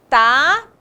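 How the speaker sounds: "Tapete" with rising "Ta", brief gap, long "pe-te"; syllable by syllable. Shown as a demonstration. Teacher naming next word for pupils, "Tapete" in stretched syllables. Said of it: "Separe-as em sílabas".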